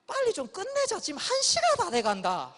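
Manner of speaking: irritated scolding. Retold or imitated. Annoyed big brother scolding.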